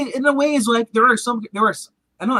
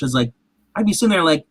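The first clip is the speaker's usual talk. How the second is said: ordinary delivery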